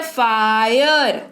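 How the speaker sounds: फायर stretched slowly, "faa-yur"; slow teacher's model of फायर with a long "aay"; audible "aay" sound. Shown as a demonstration. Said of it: "आय सुनाई दे रहा है".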